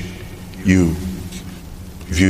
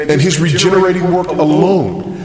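higher, rushing, urgent sermon build; impassioned preaching; 9 semitones higher than usual. Real, not acted. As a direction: preach it in one driving, breathless run.

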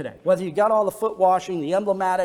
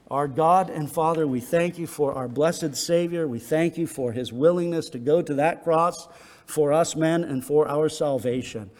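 lower, steady prayer voice; closing prayer; 6 semitones lower than usual. Acted. Pastor leads the congregation in closing prayer, low and reverent.

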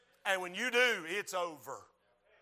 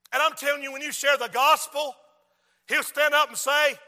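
loud, raised sermon voice; full-force preaching; 7 semitones higher, 10 dB louder than usual. Real, not acted. Preacher warning those who share the gospel, full-force preaching. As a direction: press the warning on the congregation.

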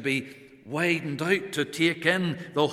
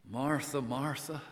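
soft, tender double call; gentle rebuke; 4 semitones lower, 11 dB quieter than usual. Acted. The Lord gently rebuking Martha, soft tender call. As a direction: rebuke her gently and quietly. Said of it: "that gentle rebuke to Martha".

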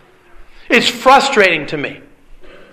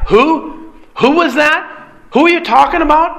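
high, fast baffled questions; puzzled forgetting; 7 semitones higher than usual. Acted. Later generations puzzled by a forgotten name, asking fast and high. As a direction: ask it as if the name means nothing.

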